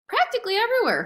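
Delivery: high, emphatic exclamation; animated reply. A narrator's reading. Hermes answers his brother's question, animated and high. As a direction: throw it out high and loud.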